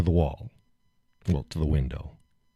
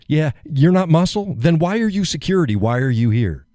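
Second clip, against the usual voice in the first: higher, sarcastic challenge; scornful retort; 9 semitones higher, 3 dB louder than usual. Retold or imitated. Man mocking security's claim they're not muscle. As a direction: fire back the questions scornfully. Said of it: "Oh, we're not muscle".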